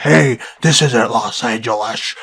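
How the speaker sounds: mild mock yell, drawn out; imitated yelling. Storyteller imitates a man yelling at the family, half-yelled. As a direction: slowly imitate a ranting stranger. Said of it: "he kinda just yells at us".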